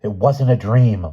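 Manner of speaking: explosive protest; exploding. A narrator's reading. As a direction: burst out in furious denial. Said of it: "Lynn exploded".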